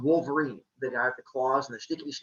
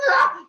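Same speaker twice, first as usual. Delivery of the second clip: a loud battle cry; attack yell; 8 dB louder than usual. Acted. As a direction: shout as you charge. Cronus attacks.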